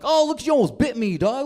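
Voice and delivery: hammed-up amazement to flatter a pet; exaggerated scare, talking to the dog; mock alarm. Pretends dog nearly bit him.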